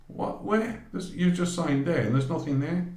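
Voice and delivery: high, baffled questioning; puzzled companion, pitched high; incredulous. Retold or imitated. Miriam joins in, baffled.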